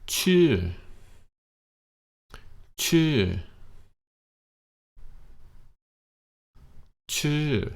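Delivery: each Chu pitched high then falling; high falling Chu, over and over; repeated falling tone model. Shown as a demonstration. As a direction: model Chu slowly for the learner to copy. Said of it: "you wanna try that?".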